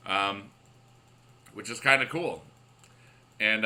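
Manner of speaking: ordinary delivery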